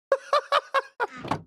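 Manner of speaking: high-pitched staccato laugh. Real, not acted. Streamer cracking up at own innuendo, high laugh. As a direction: burst into high, rapid laughter.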